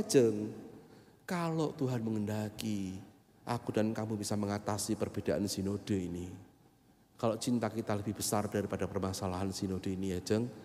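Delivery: soft low courting voice; tender plea. Retold or imitated. Young man wooing his girlfriend over the synod divide, soft and low. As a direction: woo her gently and quietly.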